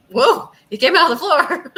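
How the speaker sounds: high, loud burst of shock; startled yelp. Pretend. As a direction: cry out in sudden alarm. Startled by figure in game.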